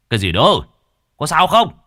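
loud, rising, urgent demand; alarmed shout. A narrator's reading. Husband runs down at his wife's scream, alarmed shouting. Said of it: "hớt hải chạy xuống quát".

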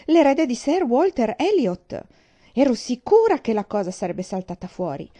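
loud, high, slowed triumph; ecstatic shout. A narrator's reading. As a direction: hammer home that she was right. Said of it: "gridò Mary in estasi". (grido estatico e trionfante)

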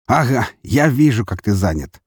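teasing jab, starts high and loud; wry ribbing; amused scoff. A narrator's reading. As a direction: scoff lightly at a busy friend. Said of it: "усмехнулся этот громила".

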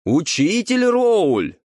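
high, loud, drawn-out summons; loud call. A narrator's reading. Someone loudly summons the teacher, calling out. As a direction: call out slowly at full voice. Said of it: "раздался громкий".

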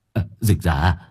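loud, rising, panicked begging; panicked pleading. Acted. Hải begging Hân through the wall, loud and panicked. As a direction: beg her to stop, flustered. Wife dragging him, he pleads.

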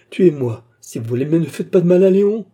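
loud desperate plea; anguished begging, rising to a cry. A narrator's reading. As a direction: implore him, climbing high and loud. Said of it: "s'écria la pauvre fille".

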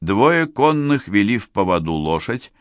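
ordinary delivery

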